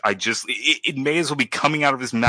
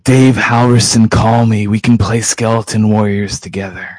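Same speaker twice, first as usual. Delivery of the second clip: calling out; 8 dB louder than usual. Pretend. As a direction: boom it out like a public appeal. Shouted plea to Dave Halverson.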